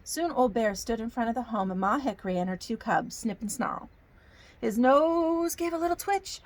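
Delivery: put-on storybook narration in a folksy Southern accent; hammy Southern storytelling accent. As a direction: read it like a Southern yarn for children. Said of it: "I get a Southern accent".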